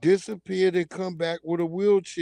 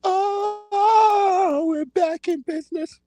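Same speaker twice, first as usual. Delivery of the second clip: much higher, gleeful cry; gleeful scream; 13 semitones higher, much slower than usual. Retold or imitated. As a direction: crow with delight, high and hammed-up.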